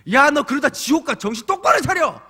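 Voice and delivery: high, loud mock hellfire warning to teens; strong preaching. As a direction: shout the warning like a stern youth preacher. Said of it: "이런 설교, 강한 설교". (강한 설교를 흉내 낸 외침)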